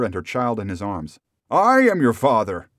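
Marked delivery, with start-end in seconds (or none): 1.5-2.7 loud, higher-pitched outburst; crying out. A narrator's reading. Rip reveals himself to his daughter, crying out. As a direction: declare it at full emotion. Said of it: "cried he".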